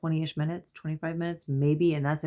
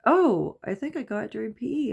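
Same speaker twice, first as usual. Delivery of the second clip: lightly voiced child, higher pitch; casual kid reply; 7 semitones higher than usual. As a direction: start high on OH, then toss it off quickly.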